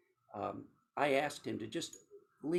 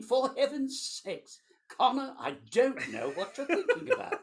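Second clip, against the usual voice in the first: high, louder put-on cultured non-American accent; 12 semitones higher, 6 dB louder than usual. Denying to Connor he has accents, in a high cultured non-American accent. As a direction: play a prim, affected cultured gent, much higher. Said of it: "we should have just done this entire conversation in that accent".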